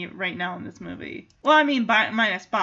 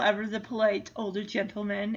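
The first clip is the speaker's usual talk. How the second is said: usual speaking voice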